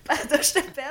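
high burst of real laughter; laughing. Real, not acted. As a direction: laugh it off, then apologise. Laughs at the family joke.

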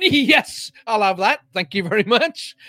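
high, loud burst of delighted acceptance; retold delight. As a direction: jump on the offer with exaggerated glee. Retelling delight at getting 007 handle.

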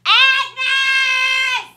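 loud, high crying call. Acted. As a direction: call her name across the house. Calling out for Agnes.

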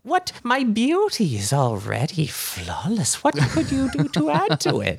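high, indignant witch voice; offended old hag. Acted. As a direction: scoff like a vain old hag. Hag takes offence at makeup.

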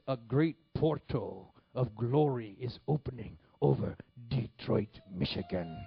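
slow, booming prophetic impersonation; Mahesh Chandra. Acted. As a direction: declaim it loud and stretched, as a visionary preacher. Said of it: "this is how he talked".

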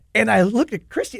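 normal talking voice

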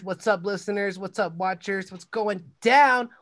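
loud, pumped shout-outs to the fans; host greeting the audience, loud and pumped; hype greeting. Acted. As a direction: greet the crowd like a hype man. Hyped greeting to listeners.

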